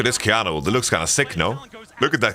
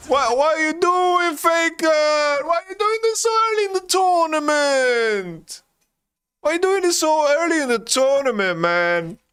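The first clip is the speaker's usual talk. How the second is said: high anguished plea; 13 semitones higher than usual. Real, not acted. Pleading with Faker over the play.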